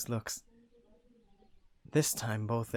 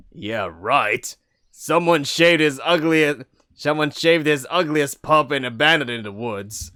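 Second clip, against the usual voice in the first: sarcastic; 4 semitones higher, 14 dB louder than usual. Acted. Sarcastic retort.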